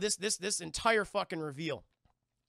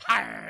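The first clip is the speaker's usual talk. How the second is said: loud mock pirate roar; Rush's villain roar; 4 semitones higher, 10 dB louder than usual. Retelling how the skeletal pirate goes, mock roar. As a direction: imitate a menacing movie snarl, loud. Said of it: "like, a Jeffrey Rush is going".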